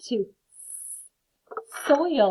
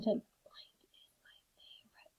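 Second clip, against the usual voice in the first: soft breathy whisper; teacher whispering the next sentence for the class; 15 dB quieter, slower than usual. Shown as a demonstration. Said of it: "in a whisper voice".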